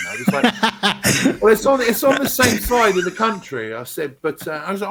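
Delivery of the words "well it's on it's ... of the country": higher and louder, giggling; laughing as he tells it; giggly, louder reply; laughing through it. Real, not acted. Laughing while retelling own reply.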